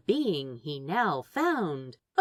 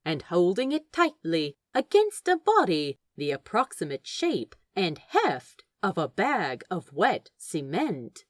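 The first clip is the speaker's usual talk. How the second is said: plain reading voice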